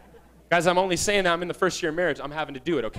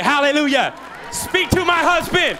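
higher, louder, slowed mock cheer; cheering wives; 6 semitones higher, 4 dB louder than usual. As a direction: shout it like a church wife who agrees. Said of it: "all the wives are like".

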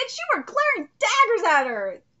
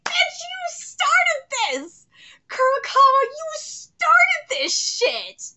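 drawn-out high yell; 10 semitones higher, 4 dB louder, much slower than usual. Real, not acted. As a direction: shout it slowly at her. Yelling at character on screen.

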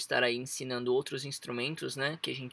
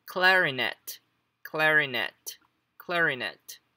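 clarinet modelled carefully, each take falling; marked word stress; 2 semitones higher, 5 dB louder, much slower than usual. Shown as a demonstration. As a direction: say clarinet as a teacher's model, repeated with pauses. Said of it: "o clarinete vai entrar aqui". (clarinet pronunciado como modelo, com entonação marcada)

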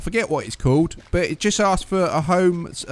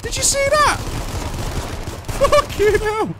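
real shocked yell; slower than usual. Real, not acted. Ambushed by a gang in the game, real shocked yelling.

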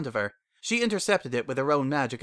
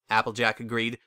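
usual speaking voice

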